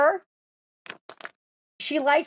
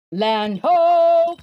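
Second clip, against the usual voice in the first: shouted land ho; 6 semitones higher, 8 dB louder than usual. Shown as a demonstration. Owner shows her dog how to shout to the boat, loud call. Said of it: "you gotta shout to everybody on the boat".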